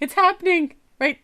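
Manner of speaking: high, loud excited repeat. Real, not acted. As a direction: squeal it out with glee. Repeats, higher and louder.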